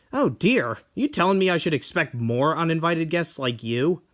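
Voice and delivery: ordinary delivery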